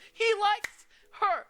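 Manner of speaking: very high, loud, drawn-out squeal of glee; gleeful shout. Acted. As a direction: shriek it out like you've caught a secret crush. Teasing gleeful shout about crush.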